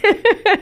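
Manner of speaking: short high laugh; real amused laugh; bright laugh. Real, not acted. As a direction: laugh out at the joke. Host laughs at guest's joke.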